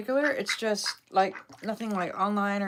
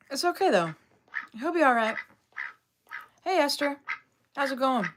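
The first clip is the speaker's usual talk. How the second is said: pet voice; 5 semitones higher than usual. Real, not acted. Greeting the pets sweetly.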